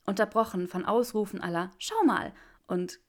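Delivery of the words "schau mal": high, bright-eyed exclamation, louder; thrilled to show her results; enthusiastic. A narrator's reading. Granger showing Draco her results, enthusiastic and high. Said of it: "erklärte sie enthusiastisch".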